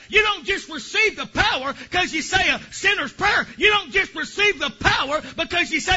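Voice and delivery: loud, high, shouting preacher cadence; full-force preaching. Acted. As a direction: preach it hard to the congregation. Preaching the Holy Ghost power.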